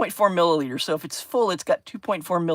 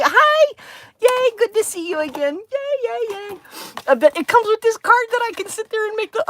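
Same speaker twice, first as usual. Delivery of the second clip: excited greeting. Real, not acted. Greeting returning viewer.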